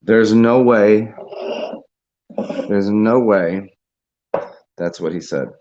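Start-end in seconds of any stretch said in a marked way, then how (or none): 0.0-3.8 loud, slow, stretched-out incredulity; flat-out disbelief. Real, not acted. Host after looking up what he said, loudly incredulous. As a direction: drag out each word, refusing to believe it.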